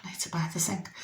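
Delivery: unvoiced, under the breath; whispered thought. Recalling her own thought about the sink, whispered.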